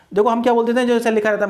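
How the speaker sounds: ordinary delivery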